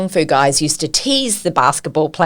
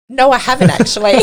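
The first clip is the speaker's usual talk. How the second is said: ordinary delivery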